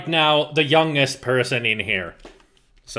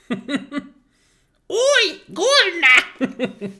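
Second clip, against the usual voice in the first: laughing, high mock British accent; fail at a Monty Python British accent; hammed-up British accent through laughter; bad British accent; 14 semitones higher, much slower than usual. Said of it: "If Guts did a British accent".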